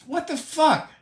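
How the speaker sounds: high, incredulous what the fuck; exasperated outburst. Real, not acted. Ranting about Russia's war, high incredulous outburst. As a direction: throw up your hands in disbelief.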